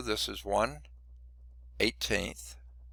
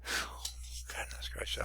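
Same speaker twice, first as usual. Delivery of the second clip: breathy whispered exclamation; 13 dB quieter than usual. Real, not acted. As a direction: whisper it, worn out. Tired sigh after long working.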